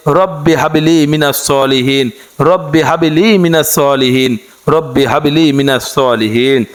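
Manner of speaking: slow, measured Arabic prayer chant; dua recitation. Acted. Teacher reciting the morning dua to listeners, slow chant. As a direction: draw each phrase out like a prayer.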